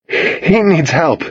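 high, slow, stricken plea; distressed urgency. A narrator's reading. Owner to friend over the wounded dog, urgent. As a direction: press for help through shock.

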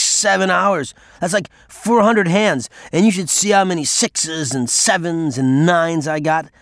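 put-on a sloppy loud drunk needling the dealer; loud drunken voice. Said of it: "talking in a loud, drunken voice".

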